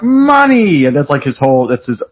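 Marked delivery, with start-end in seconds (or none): 0.0-0.8 greedy cry. Retold or imitated. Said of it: "It's like".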